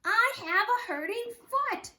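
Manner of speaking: high, loud call from far behind; child shouting from behind. Acted. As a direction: call out across the street. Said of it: "so I had to shout".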